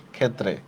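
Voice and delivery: teacher shows ক্ষেত্রে with a doubled t; careful model pronunciation. Shown as a demonstration. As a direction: spell out ক্ষেত্রে as khettre for the class. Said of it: "ক্ষেত্রে শব্দের উচ্চারণটা হচ্ছে এইভাবে".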